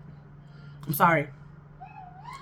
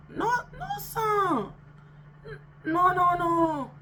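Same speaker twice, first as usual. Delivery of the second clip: high singsong refusal; 8 semitones higher, much slower than usual. Real, not acted.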